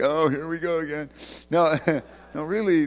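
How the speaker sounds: ordinary talk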